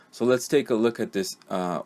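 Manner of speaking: normal talking voice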